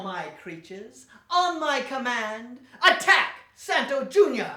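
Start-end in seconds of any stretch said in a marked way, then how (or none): 1.3-4.5 rally the wax monsters to attack; hammed-up evil command; villain's battle cry. Villain commands his creatures.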